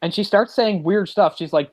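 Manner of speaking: ordinary delivery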